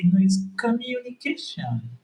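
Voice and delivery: high-pitched imitation of his partner correcting him; exaggerated, high-voiced parody of a pronunciation correction; mimicked correction. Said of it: "you are correcting me and say".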